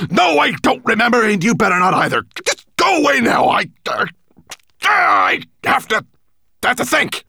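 loud, high-pitched outburst; furious shouting. Acted. Nettlebrand sending the raven away to think, furious. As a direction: shout him away, breaking off in exasperation.

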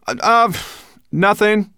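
hesitant, high, loud denial; muttering. A narrator's reading. Ben hiding the quarrel from the dragon, muttering. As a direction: stammer out a cover-up. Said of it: "muttered Ben".

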